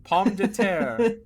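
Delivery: slow, high mock French; hammed-up French, drawn out; French accent.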